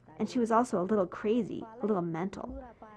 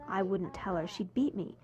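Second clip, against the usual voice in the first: ordinary talk, nothing marked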